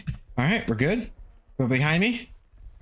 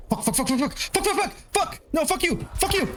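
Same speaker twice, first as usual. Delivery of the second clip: rapid frantic fucks climbing much higher; panicked cursing. Real, not acted. Gamer panicking at the monster chasing him, frantic high cursing. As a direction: spiral into real panic as it closes in.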